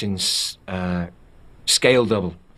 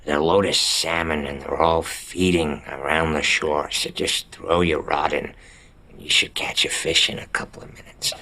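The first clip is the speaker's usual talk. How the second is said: retold friend's voice; 4 semitones lower than usual. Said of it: "ran in and, and said".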